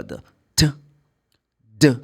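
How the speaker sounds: "t" and "d" as short sounds, not letter names; isolated ending sounds. Shown as a demonstration. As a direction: give "t", then "d", each alone. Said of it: "three ways to pronounce the E D".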